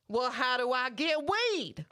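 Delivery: distressed mock Southern customer; Southern accent. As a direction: imitate a pleading Southern lady. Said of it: "I did the accent".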